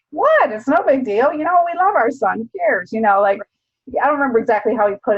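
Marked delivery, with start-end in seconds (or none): 0.1-2.9 imitating the dad, high and loud on the first word, then easing; lightly voiced dad, brushing off the worry; dad's voice. Dad telling his son it's no big deal, lightly imitated.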